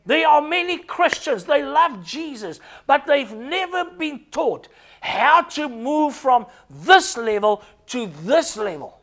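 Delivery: high, insistent pulpit delivery; fervent preaching. Real, not acted. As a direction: drive each phrase home like a preacher. Preaches with fervour.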